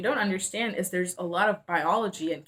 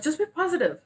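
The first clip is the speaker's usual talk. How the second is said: higher-pitched mock dad voice; imitating dad; 9 semitones higher, faster than usual. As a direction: toss it off like a dismissive dad. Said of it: "He's like".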